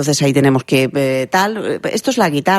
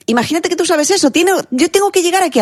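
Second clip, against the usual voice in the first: much higher voice, reaching way up; demo high pitch. Shown as a demonstration. Voice coach showing how high to reach, much higher. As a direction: jump up to the top of your range. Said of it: "subir el tono de la voz".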